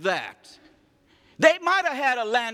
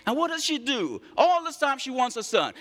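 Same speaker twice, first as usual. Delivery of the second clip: ordinary delivery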